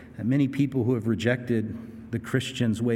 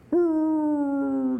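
a high howling woo; jackal howl. Retold or imitated. Micah voiced crying out like jackals, high howl. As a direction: wail like a jackal. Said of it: "I'm gonna cry out like jackals".

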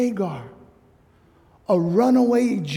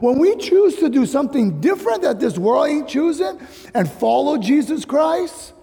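higher and louder, slowing to drive each "choose" home; fervent preaching; 6 semitones higher, 3 dB louder than usual. Acted. Pastor urging the congregation to obey, fervent preaching. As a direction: preach it with rising fervour.

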